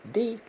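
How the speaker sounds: D said alone as one syllable; D spoken by itself; first syllable. Shown as a demonstration. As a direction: isolate the syllable D. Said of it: "First syllable".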